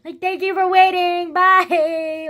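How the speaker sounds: loud, high happy send-off; overjoyed farewell. Retold or imitated. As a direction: call out a gleeful goodbye. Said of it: "I was just so happy".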